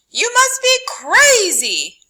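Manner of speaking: high, loud, incredulous official; loud high voice of a stern police officer; police scolding. A narrator's reading. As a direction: shout it up high like a shocked policeman. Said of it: "they would say".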